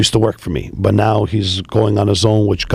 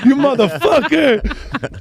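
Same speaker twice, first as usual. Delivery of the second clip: laughing mockery; 13 semitones higher, much slower than usual. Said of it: "He's like, he started laughing".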